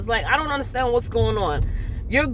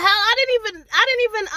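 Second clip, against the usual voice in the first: sputtering outrage; 10 semitones higher, 3 dB louder, faster than usual. Real, not acted.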